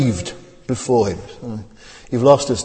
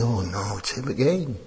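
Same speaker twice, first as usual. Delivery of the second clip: weary dismissal; 10 dB quieter than usual. Retold or imitated. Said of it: "They're saying".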